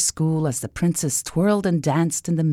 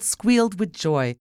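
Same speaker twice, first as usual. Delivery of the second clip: usual speaking voice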